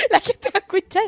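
laughing at the story while she tells it. Real, not acted. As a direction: giggle it out, half embarrassed. Laughing at her own retelling.

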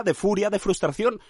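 usual speaking voice

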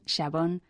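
x in xabón said as English sh; old Castilian xabón. Shown as a demonstration. Lecturer showing old xabón with English S H, sh for x. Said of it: "con S H inglesa".

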